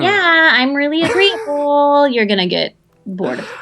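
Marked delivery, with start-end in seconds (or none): 0.0-2.1 high, slow, dopey people-pleaser voice; breathy agreeable airhead; airhead girl voice. Retold or imitated. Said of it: "I love when you do that voice".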